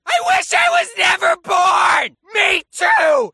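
very high, loud wailing shout; over-the-top hysterical yelling; screaming family fight. Acted. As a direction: scream it as a hammed-up total meltdown. Acted family quarrel peaks.

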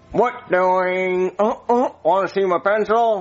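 put-on drawn-out puppet kid voice; Jeffy. Shown as a demonstration. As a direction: imitate a dopey child character. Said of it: "I wanna hear your Jeffy voice".

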